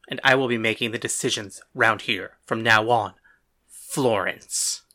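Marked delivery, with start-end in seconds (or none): 3.7-4.8 low, disdainful mayor voice. A narrator's reading. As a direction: say the name with deep contempt. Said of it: "said her name with disdain".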